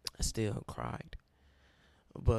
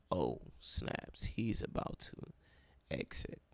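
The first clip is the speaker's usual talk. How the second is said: soft, breathy near-whisper, much quieter; hushed aside; 7 semitones lower, 6 dB quieter, slower than usual. Retold or imitated. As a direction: let it slip out under your breath like a secret. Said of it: "they would see".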